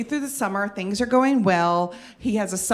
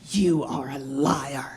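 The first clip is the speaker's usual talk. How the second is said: boss accusing; 6 semitones lower, slower than usual. Retold or imitated. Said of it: "turns even redder and angrier".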